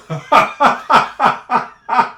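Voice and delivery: loud, high burst of laughter; real laugh. Real, not acted. Laughing at own joke about Florida, loud real laugh. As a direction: let a real laugh break out.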